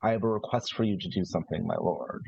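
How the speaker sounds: usual speaking voice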